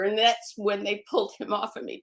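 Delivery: ordinary talk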